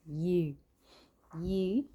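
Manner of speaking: ordinary delivery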